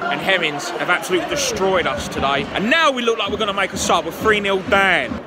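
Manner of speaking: loud disbelief at the third goal; angry outburst. Real, not acted. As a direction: shout the damage done. Third goal conceded, ranting.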